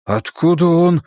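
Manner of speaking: потрясённо, громче и выше; вырывается от изумления; ошеломлённо. A narrator's reading. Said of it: "начал ошеломлённо Брус". (ошеломлённый возглас)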